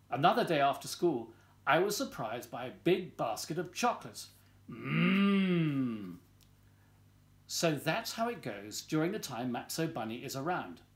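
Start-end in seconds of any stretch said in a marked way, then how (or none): 4.7-6.2 long savoring hum of delight; contented drawn-out mmm; yummy mmm. Acted. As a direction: hum as if tasting a chocolate. Basket of chocolates, savoring.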